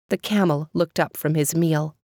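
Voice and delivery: ordinary delivery, nothing marked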